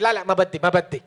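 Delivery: quick, loud imitated Lebanese refusal; punchy Lebanese-accented brush-off; Lebanese woman. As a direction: mimic a Lebanese woman cutting someone off. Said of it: "she's like".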